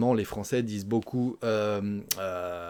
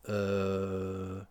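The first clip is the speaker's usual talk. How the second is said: long flat drawn-out euh; hesitation euh; 5 semitones lower, 7 dB quieter than usual. Shown as a demonstration. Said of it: "on va dire". (long "euh" d'hésitation)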